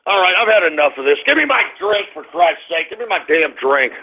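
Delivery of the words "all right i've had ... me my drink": ordinary talk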